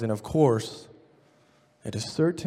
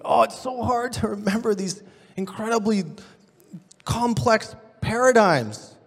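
whiny students; 6 semitones higher than usual. Retold or imitated. Students complaining about paradigms.

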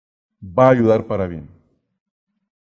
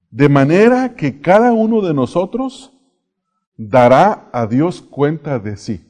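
scripture reading; 4 dB louder, slower than usual. Acted. Reads scripture aloud.

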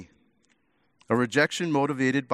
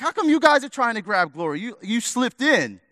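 higher, mock-indignant complaint; indignant disciples; 8 semitones higher than usual. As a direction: whine it like jealous disciples caught out. Angry disciples voiced in retelling.

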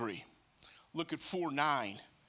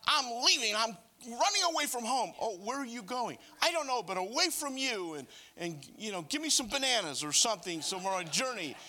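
put-on higher, louder pouting child threatening to leave home; runaway child; 6 semitones higher, 3 dB louder than usual. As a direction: play a huffy kid running away. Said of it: "like a little child saying".

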